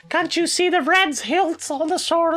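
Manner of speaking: high-pitched, exasperated scolding; outraged rant. Acted. Collector correcting idiots on Sir Blake, high outraged rant. Acted rant at a wrong guess.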